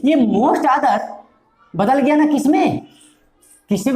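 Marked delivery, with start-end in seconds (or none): none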